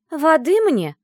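loud, high imperious demand. Acted. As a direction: demand it as if kept waiting. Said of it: "требовательно повторила хвостатая девушка".

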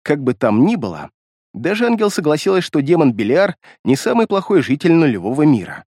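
ordinary delivery, nothing marked

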